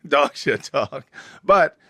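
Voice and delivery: ordinary talk, nothing marked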